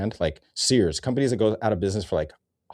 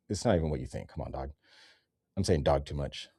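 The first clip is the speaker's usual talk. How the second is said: ordinary talk, nothing marked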